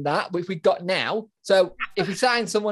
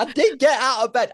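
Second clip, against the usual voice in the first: laughing; 6 semitones higher, 4 dB louder than usual. Real, not acted. Laughing repeat.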